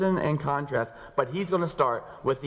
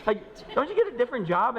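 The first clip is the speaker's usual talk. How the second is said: incredulous; 11 semitones higher than usual. Retold or imitated. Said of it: "you look at them and go".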